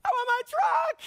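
put-on very high, loud wailing kid voice; whiny child. Boy refusing the inheritance, loud high whine. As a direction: wail it like a toddler who wants only his toy. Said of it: "The boy's like".

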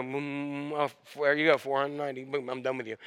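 low, soft counting to oneself; mock tallying aloud. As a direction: act out keeping score under your breath. Acts someone tallying forgiveness, then quitting.

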